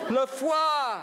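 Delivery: laughing regal shout; triumphant shout. Acted. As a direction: crow it out like the king of France. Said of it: "Suddenly I am the king of France".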